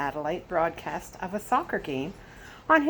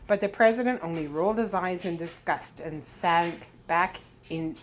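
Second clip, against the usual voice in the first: ordinary delivery, nothing marked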